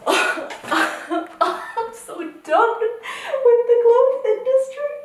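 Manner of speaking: laughing despair that peaks in a high squeak; exasperated laughing. Real, not acted. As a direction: laugh it out in fed-up disbelief, pitch climbing high. Laughing rant at clothes brand.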